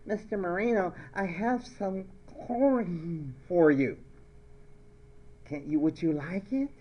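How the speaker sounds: playacted peculiar student; odd put-on voice. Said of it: "Why are you talking like that?".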